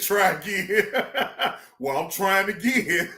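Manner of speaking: words broken by giggles; laughing through words. Real, not acted. Streamer laughing about trying again after the ban. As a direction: talk while still laughing. Laughing at his own retelling.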